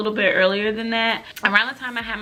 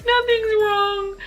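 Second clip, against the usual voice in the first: high, drawn-out mock-crying whine; tearful denial; 12 semitones higher, slower than usual. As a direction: deny it through hammed-up tears. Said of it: "he could hear it in my voice".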